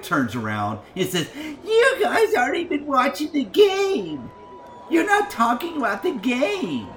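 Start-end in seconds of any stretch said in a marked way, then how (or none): 1.3-6.9 high, screechy imitation of a nagging wife; mock her in a drawn-out high-pitched shout; mocking scolding wife. Mocking the angry wife turning round.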